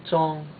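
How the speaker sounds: "Zhong" pitched high and held level; high first tone. Shown as a demonstration. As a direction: keep "Zhong" high all through. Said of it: "You wanna keep it high then".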